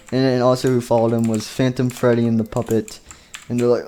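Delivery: usual speaking voice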